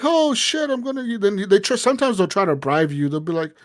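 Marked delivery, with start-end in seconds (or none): 0.0-1.2 high startled "oh shit"; mock alarm. Smuggler caught by the cop, high mock alarm. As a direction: yelp it up high like a caught crook.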